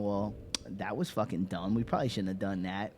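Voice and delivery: low, soft, sheepish understatement; deadpan regret. Retold or imitated. Survivors looking around after the nukes, deadpan regret.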